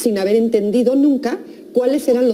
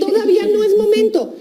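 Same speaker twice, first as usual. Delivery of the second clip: put-on high little-kid voice; unbothered child; 11 semitones higher than usual. Child keeps playing after the first bath call, unbothered. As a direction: pitch it way up as a child putting things off.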